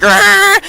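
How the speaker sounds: very high, loud mock roar; troll roar. The troll comes out.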